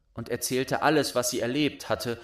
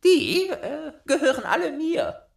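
put-on much higher, loud, laughing child voice; proud laughing robber girl; 8 dB louder than usual. A narrator's reading. Robber girl showing Gerda her doves, proud laughing voice. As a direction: show off the animals gleefully.

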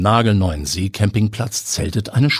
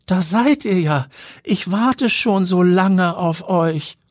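put-on old lady voice; 11 semitones higher, slower than usual. A narrator's reading. Old lady greets long-lost love.

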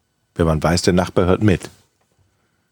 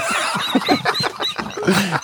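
high breathy giggle; 10 semitones higher, 4 dB quieter than usual. Real, not acted. Laughing at own joke.

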